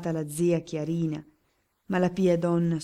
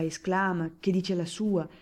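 ordinary delivery, nothing marked

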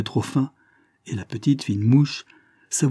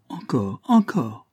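put-on excited child; 3 semitones higher, slower than usual. A narrator's reading. Little girl on the old man's knees, begging excitedly. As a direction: beg like a thrilled little girl.

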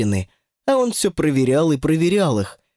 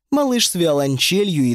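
ordinary delivery

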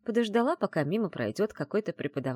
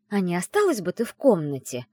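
sarcastic, louder suggestion; caustic hiss; 3 semitones higher, 6 dB louder than usual. A narrator's reading. Heroine telling teasing friend to stay, caustic. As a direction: needle her with a mildly venomous question. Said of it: "язвительно прошипела я".

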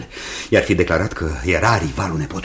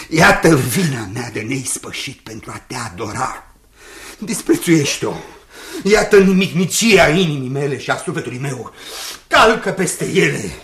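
rising higher and louder, near shouting; frenzied pleading; 8 semitones higher, 5 dB louder than usual. Acted. Jasper pouring out frantic love at Rose's feet. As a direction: build into a wild, desperate outpouring.

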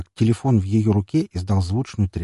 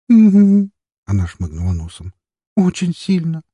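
tearful; 9 semitones higher, 7 dB louder than usual. A narrator's reading. Tearful girl after sniffing.

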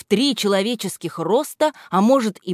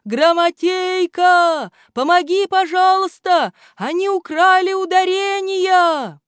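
desperate shout; 12 semitones higher, 5 dB louder than usual. Acted. Desperate plea for help.

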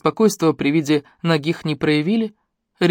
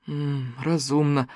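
glum; 2 semitones lower, 8 dB quieter, much slower than usual. A narrator's reading. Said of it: "с унылым выражением лица".

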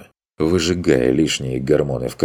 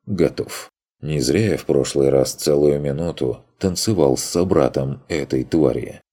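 usual speaking voice

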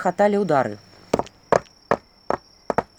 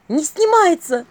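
high, loud cry of alarm; panicked cry; 8 semitones higher, 7 dB louder, slower than usual. A narrator's reading. Vasilisa finding the ring stuck, panicked cry. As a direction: burst out in panic. Ring will not come off.

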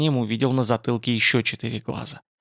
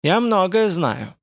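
slow, higher, lightly creaking answer; creaky voice; 7 semitones higher, slower than usual. A narrator's reading. Spider-man answers his visitor's question, slow and lightly creaky. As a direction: draw it out high with a light creak. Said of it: "Он ответил скрипучим голосом".

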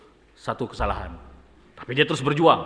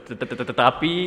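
stuttering; 2 semitones lower than usual. Acted. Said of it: "Langsung jadi gagap".

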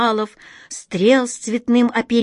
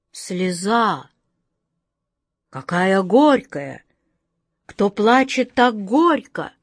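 put-on wild-eyed old man; slower than usual. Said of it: "Дико озираясь".

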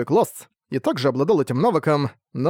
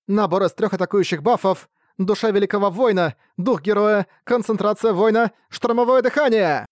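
higher, rising skill announcements; skill call; 6 semitones higher than usual. Acted. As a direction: build hype with each skill. Skills called out in battle.

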